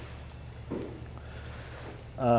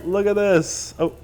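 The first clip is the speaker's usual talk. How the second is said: normal talking voice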